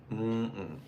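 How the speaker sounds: ordinary talk, nothing marked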